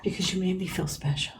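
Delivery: fast, hushed whisper. Acted. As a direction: breathe it out as a secret whisper. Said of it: "why are you whispering?".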